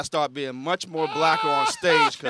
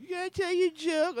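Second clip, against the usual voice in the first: very high mock-drunk woman's voice; high, woozy drunk lady; 14 semitones higher than usual. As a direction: imitate a tipsy fan in falsetto. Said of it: "she's drunk".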